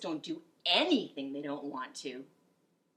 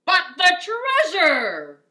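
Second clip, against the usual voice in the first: high, loud, slow despairing holler; captain hollering in the storm; 11 semitones higher, 16 dB louder, much slower than usual. Acted. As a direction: wail for the treasure. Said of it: "hollered Braid Beard".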